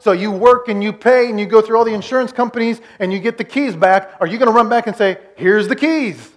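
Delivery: loud, high-pitched preacher's challenge; preaching. Acted. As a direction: press the question on the congregation, loud and high. Preaches at full force.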